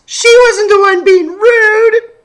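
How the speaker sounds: high, loud, tearful protest; a hurt kid pushing back through tears; upset child near tears. Acted. Sophie defends Bernice, upset.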